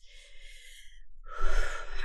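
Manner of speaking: long unvoiced sigh letting everything go; real sigh of release; deep release sigh. Real, not acted. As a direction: breathe out all the tension. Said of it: "the shift in my voice".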